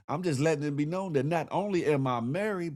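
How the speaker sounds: ordinary delivery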